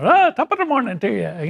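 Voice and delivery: a weak, half-hearted Irish brogue on top of the morning; Irish accent. Shown as a demonstration. Radio host asked for it, gives a poor Irish accent. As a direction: tries an Irish lilt and barely gets there. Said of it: "I'm terrible in accents".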